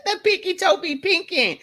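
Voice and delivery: giggling through the words, pitched high; laughing. Real, not acted. Cracking herself up on the live stream, laughing. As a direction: squeal it out mid-laugh. Laughing at her own joke.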